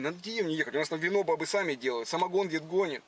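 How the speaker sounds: ordinary talk, nothing marked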